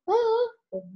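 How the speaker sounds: high, rising oh sounds for a frog. Shown as a demonstration. Child showing the teacher the frog voice, high and squeaky. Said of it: "I make the voice like this".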